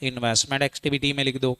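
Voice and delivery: mumbled model answer; quick murmured answer. Shown as a demonstration. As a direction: answer for the class under the breath. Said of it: "फटाफट बोलो".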